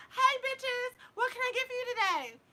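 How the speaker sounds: high, loud sing-song waiter greeting; mock rude waiter. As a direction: play the cheeky waiter greeting his table. Retold waiter greeting customers.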